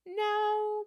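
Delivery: high, loud, stretched-out no; drawn-out retold no. Applicant answering whether the manager was told, high drawn-out no. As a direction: hold it long and high, well above normal talk.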